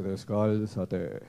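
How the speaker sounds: slow, deep, commanding voice of God; voz de Dios. Acted. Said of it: "El Señor llega y le dice". (voz de Dios, grave y muy lenta)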